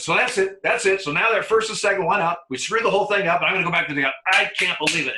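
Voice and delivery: high, loud put-on exasperation; manager's mock exit rant. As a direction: storm off in hammed-up disgust. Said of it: "he goes".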